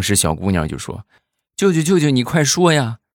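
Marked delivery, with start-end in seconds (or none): 1.6-3.0 put-on high, loud hurried child's plea; a startled kid pressing her uncle; urgent little girl. Niece in the back seat urgently nags her uncle, high and loud.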